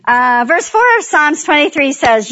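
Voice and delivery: ordinary talk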